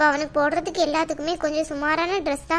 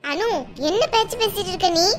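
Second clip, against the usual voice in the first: higher-pitched, indignant rebuke; sharp scolding; 4 semitones higher than usual. Acted. Elder rebukes Anu in a family quarrel, sharp scolding.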